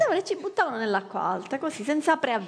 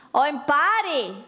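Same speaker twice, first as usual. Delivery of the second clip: slow, rising, hammed-up threat; mock ultimatum; 4 semitones higher, slower than usual. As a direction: deliver it like a brutal uncle. Uncle's ultimatum.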